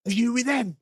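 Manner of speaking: imitating a chaser's high-pitched challenge; accusing demand. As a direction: demand it like you've caught a suspect. Acting out the man confronting him.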